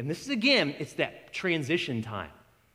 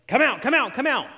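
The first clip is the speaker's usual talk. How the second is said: higher, louder mock exorcism shout; mock commanding disciples; 8 semitones higher, 5 dB louder than usual. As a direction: ham up the cocky command. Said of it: "They're like".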